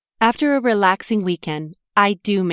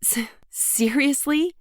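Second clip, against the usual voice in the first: halting, stunned "S-, seriously?"; stammering disbelief; 8 semitones higher, slower than usual. A narrator's reading. Narrator hearing her friend will visit, stammering in surprise. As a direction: stumble over the word in shock. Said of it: "I stammer".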